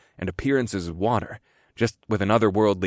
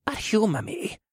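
put-on high, soft coaxing old man; patient parent humoring a child; exasperated placating; 12 semitones higher, 5 dB quieter than usual. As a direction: coax him like a toddler. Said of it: "sounded like an exasperated parent placating a toddler".

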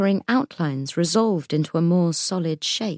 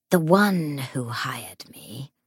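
whispering ghost; slower than usual. Acted. Ghost figure speaks.

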